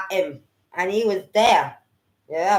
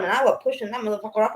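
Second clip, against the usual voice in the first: ordinary talk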